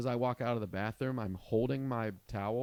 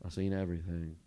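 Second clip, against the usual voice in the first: war-weary vet; 4 semitones lower, faster than usual. Retold or imitated. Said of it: "said it like he was a fucking Vietnam vet".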